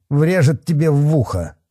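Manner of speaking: high-pitched bullying threat. A narrator's reading. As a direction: finish the threat with heat. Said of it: "повысил голос Бахметьев".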